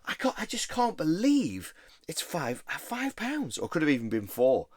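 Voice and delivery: imitating a thrilled friend at the counter; mate's excited voice. Voicing Will's excitement.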